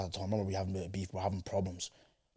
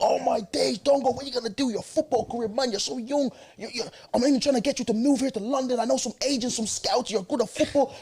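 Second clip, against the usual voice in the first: panicked cousin; 13 semitones higher than usual. Retold or imitated. Cousin panics about his football career, high and excited.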